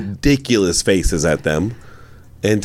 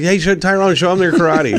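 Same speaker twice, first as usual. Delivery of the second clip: mock yell, pitched up high; retold yell; 7 semitones higher than usual. Guest yelling at the host on the red carpet, mock yell. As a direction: imitate yourself heckling from across the carpet. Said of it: "I start yelling".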